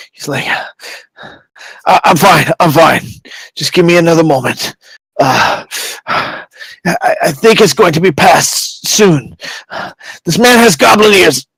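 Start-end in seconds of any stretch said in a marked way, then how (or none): 1.9-11.5 halting, then higher and insistent; strained magistrate. Acted. Hurt magistrate reassuring the others, strained and halting. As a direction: push through pain to give an order.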